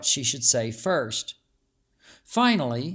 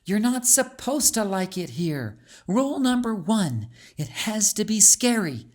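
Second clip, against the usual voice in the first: put-on higher, teasing child laying down the rules; bold young girl; 5 semitones higher than usual. A narrator's reading. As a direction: brave friend shrugging off the fear. Calixta answers friend.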